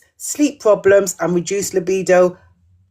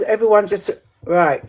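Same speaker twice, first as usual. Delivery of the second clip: ordinary talk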